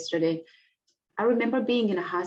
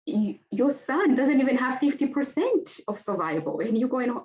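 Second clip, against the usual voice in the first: imitating incredulous doctors; 5 semitones higher than usual. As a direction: play the doctor who can't believe it, pitched up. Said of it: "My doctors were just like".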